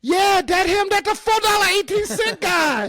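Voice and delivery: very high, loud mock accent of an old Chinese woman; an old Chinese woman recognizing him, mock accent very high; old Chinese lady accent. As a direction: point him out triumphantly in a thick Chinese accent. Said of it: "this old Chinese lady".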